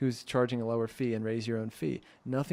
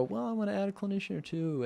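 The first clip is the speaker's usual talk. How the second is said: quick, higher, eager voice of the practice owner; rushed eager owner; 8 semitones higher, faster than usual. Retold or imitated. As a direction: act out the owner, pitched up and hurried. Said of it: "And then I go".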